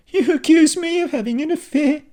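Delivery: high, loud, furious accusations; angry wife. A narrator's reading. Kylie accusing her husband of flirting, furious. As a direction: lash out at him.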